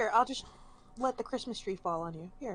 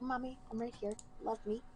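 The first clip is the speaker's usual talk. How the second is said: voicing the cat; 3 semitones higher, 10 dB quieter than usual. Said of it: "I love how she's just like".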